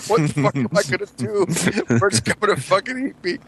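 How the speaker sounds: retold panic. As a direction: panicky kid's thoughts, played for laughs. Retold childhood panic over giant Bert.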